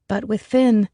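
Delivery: usual speaking voice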